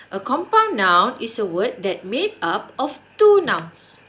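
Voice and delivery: ordinary talk, nothing marked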